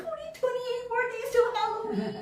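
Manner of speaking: high-pitched gleeful countdown; retold squeal of a child counting the days; excited child.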